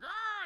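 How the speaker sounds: a contained, squealed war cry; mock attack cry. Acting out a fantasy attack.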